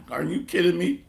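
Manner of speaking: laughing through a disbelieving question. Real, not acted. Preacher laughing at his congregation's plight, laughing. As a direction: chuckle it out, half amused.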